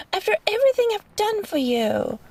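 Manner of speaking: indignant. Acted. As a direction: spit it out, offended and high. Hurt at the captive.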